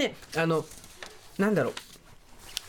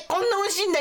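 surprised; 10 semitones higher, faster than usual. Real, not acted. Surprised by the flavor.

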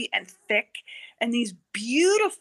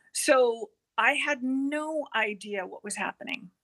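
ordinary talk, nothing marked

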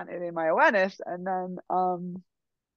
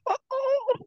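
much higher, short breathy "oh, oh"; imitated healer's gasps. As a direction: mimic the old healer's startled little cries, pitched way up. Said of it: "like this".

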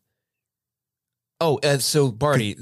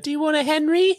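high, squeaky elf offer; Dobby impression; 4 dB louder than usual. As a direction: squeak the question like a little dealer. Dobby offering drugs with film term.

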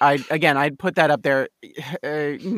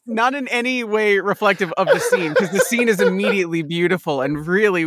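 usual speaking voice

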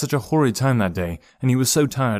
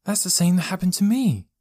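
put-on high, urgent boy's voice; exclaiming; 9 semitones higher than usual. A narrator's reading. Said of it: "exclaimed Joe".

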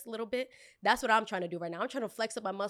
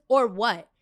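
entitled customer; 9 dB louder than usual. Retold or imitated. Customer's challenge, voiced.

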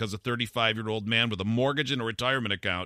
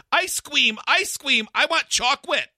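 put-on high whiny little kid voice, much higher; childlike toddler voice; 5 dB louder, slower than usual. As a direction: squeal it like an excited toddler. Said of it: "gasped like a child".

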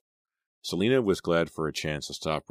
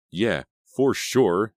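slow, loud sarcastic snarl; 5 semitones higher, 6 dB louder, much slower than usual. A narrator's reading. Girl doubting his apology, drawn-out snarl. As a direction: stretch it out with scornful disbelief. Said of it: "Selina snarled".

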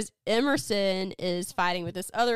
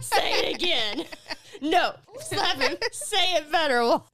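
loud, higher orders through laughter; bossy play-acting, half laughing; mock demanding; 8 semitones higher, 5 dB louder than usual. Imagined slapping and demanding scene.

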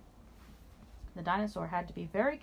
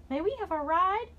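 put-on high, eager little kids asking; children's voice; 11 semitones higher, 5 dB louder than usual. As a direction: beg sweetly like small children. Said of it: "asked the children".

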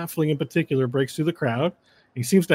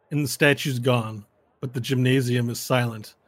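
usual speaking voice

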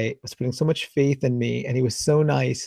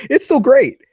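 higher and louder, brightly pleased; cheerful patient; 12 semitones higher, 8 dB louder than usual. Retold or imitated. Patient answering the therapist about his shoulder, much higher and louder. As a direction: pop it up, delighted. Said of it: "He's like".

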